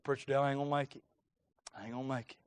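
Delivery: low, very soft defeated mumble; imitating a tearful kid. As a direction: give up quietly, as a discouraged child. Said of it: "I think he's crying".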